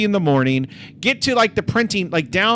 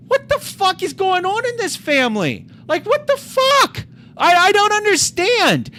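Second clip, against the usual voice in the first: very high-pitched retold bewilderment; appalled disbelief; 13 semitones higher than usual. Kid appalled at his friend's family, high-pitched disbelief. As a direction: squeal it in baffled outrage.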